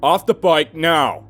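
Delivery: loud, slow commanding cop; shouting cop. A narrator's reading. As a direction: bark it like a cop. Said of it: "he shouted".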